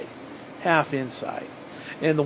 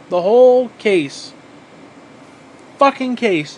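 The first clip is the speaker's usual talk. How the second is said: louder, slow, outraged; emphatic outrage; 5 semitones higher, 7 dB louder, slower than usual. Real, not acted. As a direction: hammer each word, indignant.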